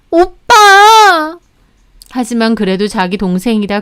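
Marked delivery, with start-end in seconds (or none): 0.1-1.4 very high, loud, long-drawn cry; wailing protest. Acted. Sister crying out to the brother who sided against her, wailing. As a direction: stretch the word out in an indignant wail.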